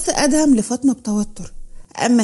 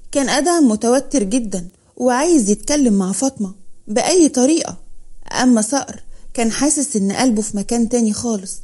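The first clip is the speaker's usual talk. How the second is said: ordinary delivery, nothing marked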